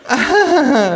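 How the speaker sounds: high, forced artificial laugh; fake laugh. A narrator's reading. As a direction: laugh politely and falsely. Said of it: "lacht die Frau künstlich". (künstliches Lachen)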